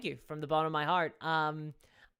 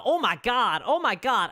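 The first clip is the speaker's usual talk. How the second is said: mock Brooklyn oh my God, higher and louder; Brooklyn accent; 7 semitones higher, 9 dB louder than usual. Retelling crane-fall bystanders, loud mock Brooklyn accent. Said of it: "it was the heaviest Brooklyn accent".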